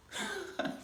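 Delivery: high burst of laughter; bright delighted laugh; joyful laugh. Acted. Delighted laugh mid-speech.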